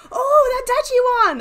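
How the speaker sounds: high, loud burst of delight; delighted cry. Real, not acted. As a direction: cry out at the prize. Sees wanted skin.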